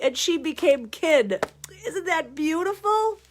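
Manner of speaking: ordinary delivery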